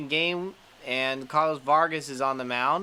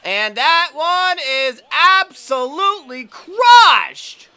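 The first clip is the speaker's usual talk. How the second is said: much louder, higher, slower; home run call; 11 semitones higher, 12 dB louder, slower than usual. Real, not acted. Gamer calls a home run, loud and slow. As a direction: belt out the homer like a broadcaster.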